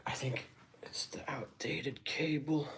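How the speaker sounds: high, soft whispery voice; hushed musing. Real, not acted. Streamer puzzling over a bad cable, hushed. Muses quietly in a stream rap.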